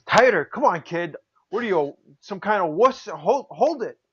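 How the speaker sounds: high, needling retold commands; mock impatient ordering. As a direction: badger a nervous kid, imitating the star. Acts out legend ordering nervous kid.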